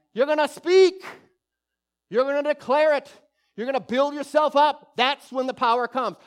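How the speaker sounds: loud, high preaching; forceful exhortation. Real, not acted. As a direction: proclaim it to the congregation with force.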